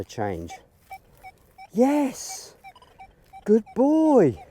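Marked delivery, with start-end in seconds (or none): 1.7-4.4 squealing high, loud praise; excited dog praise. Real, not acted. Trainer rewarding her dog Remy, high excited praise. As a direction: gush over the dog like he just won.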